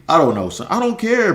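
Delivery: usual speaking voice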